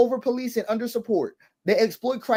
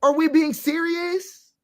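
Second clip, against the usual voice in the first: "serious" drawn out slowly, higher still; stronger disbelief; 7 semitones higher, slower than usual. Real, not acted. Pressing listeners again, slower and higher. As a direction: lean harder into the disbelief. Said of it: "incredulous repeat".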